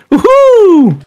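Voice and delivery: drawn-out high-pitched woohoo; whooping "woohoo". Acted. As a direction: let out a high cheer on the jump. Rescued friend jumps down.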